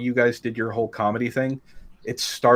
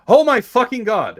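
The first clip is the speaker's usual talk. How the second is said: high, loud imitation of astonishment; mock shocked outcry; 12 semitones higher, 9 dB louder than usual. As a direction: blurt it like stunned listeners, hammed up. Retelling co-hosts' shocked reaction.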